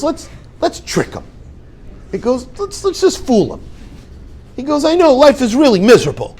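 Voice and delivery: a mischievous rabbi plotting a harmless trick; playful conspiring rabbi. Retold or imitated.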